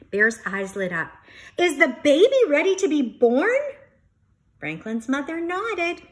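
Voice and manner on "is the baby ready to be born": fast, higher, louder excited ask; eager question. Acted. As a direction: burst out with hope.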